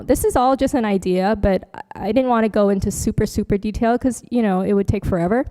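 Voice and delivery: ordinary delivery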